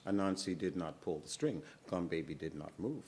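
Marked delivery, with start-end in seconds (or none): none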